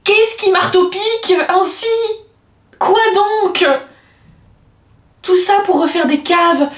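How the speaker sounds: hammed-up high neighbour rant; crazy neighbour. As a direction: complain at the landlord like the building's madwoman. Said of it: "je suis la folle".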